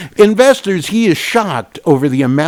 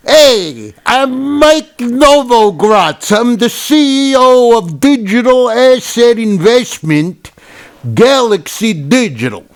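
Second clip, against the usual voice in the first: slow, higher hammed-up Italian accent; mock Italian accent; 6 semitones higher, slower than usual. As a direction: introduce yourself like a cartoon Italian. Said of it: "with my Italian accent".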